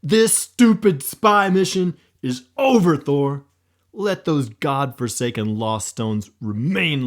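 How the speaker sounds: high, loud voice breaking with grief; high-pitched anguished outburst, slowing at the end; grieving snap. Acted. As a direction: snap at him, then break down. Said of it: "as he snapped".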